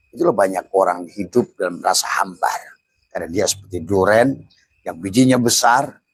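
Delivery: usual speaking voice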